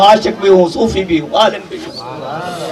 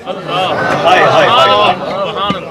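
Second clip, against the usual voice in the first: softer, slow drawn-out hi hi; lamenting sigh; 8 semitones lower, 3 dB quieter, much slower than usual. Acted. Preacher lamenting the poet's rejection with a slow hi. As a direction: grieve over the lover turned away.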